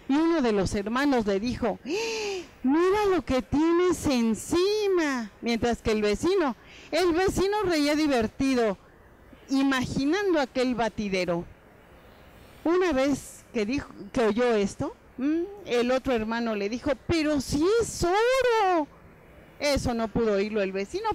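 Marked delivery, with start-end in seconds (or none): 1.8-5.3 high, slow, amazed cry at the sight; gasp, then stretch out the words in disbelief; shocked exclamation. Acted. Brother sees the filth on him. 17.1-18.9 higher, slow, wondering voice; amazed. Acted. Brother sees the gold.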